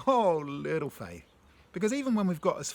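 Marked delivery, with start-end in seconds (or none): 0.1-1.3 fond, delighted, drawn-out exclamation; tender, amused chiding, stretched out slowly; joyful Jesus. Acted. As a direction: say it slowly with affectionate joy. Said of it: "Do you hear the joy in his voice?".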